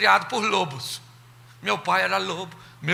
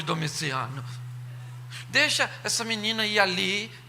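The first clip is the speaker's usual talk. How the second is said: ordinary talk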